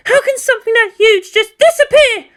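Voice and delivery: high, loud disbelieving cry; scream. Acted. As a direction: scream it at the room. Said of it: "Misato screamed out".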